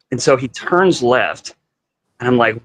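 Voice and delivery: plain reading voice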